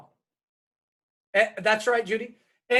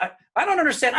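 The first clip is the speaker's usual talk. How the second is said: bewildered cry; 5 semitones higher, 4 dB louder, faster than usual. Retold or imitated. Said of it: "He goes".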